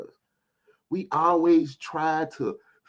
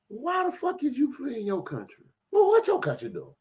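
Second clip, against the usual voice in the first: incredulous; 14 semitones higher than usual. Real, not acted. Indignant challenge to immigrants.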